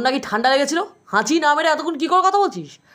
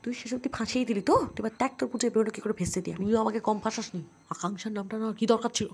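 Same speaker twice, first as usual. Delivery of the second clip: much softer sibling squabble; hushed quarrel; 4 semitones lower, 11 dB quieter than usual. Acted. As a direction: argue under your breath so mum won't hear. Siblings bicker secretly near mother.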